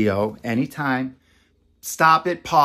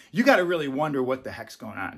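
ordinary delivery

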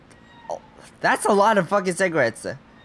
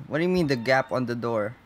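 ordinary talk

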